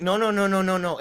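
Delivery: high-pitched string of panicked refusals; retold panic. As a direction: replay rising panic without shouting. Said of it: "it's panic, full blown".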